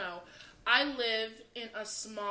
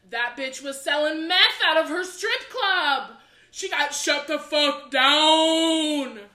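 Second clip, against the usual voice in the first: much louder and higher, slow, dragged out; dramatic reveal; 5 semitones higher, 15 dB louder, slower than usual. Retold or imitated. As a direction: land the gossip like a bombshell. Sister delivers shocking news.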